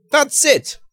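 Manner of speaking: sudden high burst of realisation; high, loud eureka cry; excited exclamation. A narrator's reading. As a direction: blurt it out as the idea hits. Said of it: "Marks exclaimed".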